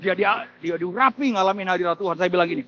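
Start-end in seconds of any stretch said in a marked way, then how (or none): none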